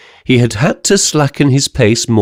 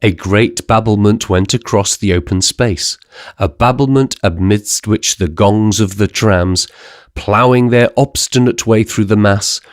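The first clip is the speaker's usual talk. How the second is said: ordinary delivery, nothing marked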